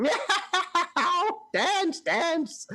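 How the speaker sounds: high, laughing cat-like cry; Leary enjoying the chaos, high and laughing; cackling Leary. Acted. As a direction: meow gleefully at the chaos.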